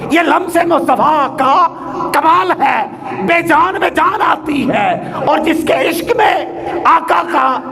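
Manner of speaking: high, ringing sermon delivery; impassioned preaching. Real, not acted. Preacher praising the Prophet's miracle to the congregation, impassioned preaching.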